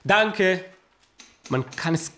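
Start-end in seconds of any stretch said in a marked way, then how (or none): 0.0-0.6 high, urgent calls of stopp; calling stop. Real, not acted. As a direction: cut the scene short, calling out high. Director halts the rehearsal.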